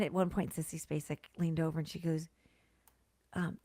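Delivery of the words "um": ordinary delivery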